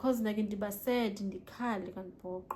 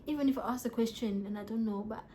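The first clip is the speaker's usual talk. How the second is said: normal talking voice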